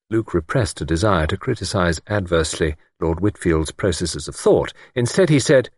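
ordinary talk

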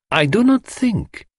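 very high, slow, insistent; Poirot, pointed challenge. Acted. Poirot counters the husband's protest, pointedly. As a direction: cast pointed doubt on him.

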